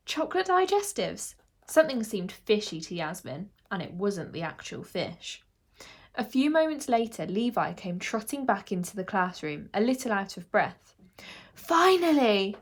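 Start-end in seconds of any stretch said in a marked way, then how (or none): 0.1-1.3 high, incredulous echo; puzzled disbelief. A narrator's reading. Yasmin puzzled at what she heard. 11.5-12.6 high, loud, drawn-out relief; exhaled relief. A narrator's reading. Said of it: "he exhaled".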